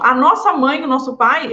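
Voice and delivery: normal talking voice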